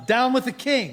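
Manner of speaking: put-on American rebel slogan, natural accent; convincing American accent. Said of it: "a convincing American accent".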